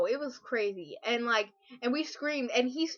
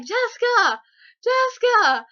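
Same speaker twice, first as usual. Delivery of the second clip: high loud calling out; calling her name; 11 semitones higher, 11 dB louder, slower than usual. Retold or imitated. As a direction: call her name across the room, excited. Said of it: "he went, like".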